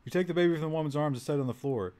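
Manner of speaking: ordinary talk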